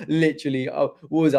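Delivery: ordinary talk, nothing marked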